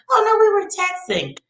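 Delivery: high, breezy mock kid voice; kid's casual reply. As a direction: shrug it off like a teenager. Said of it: "you be like".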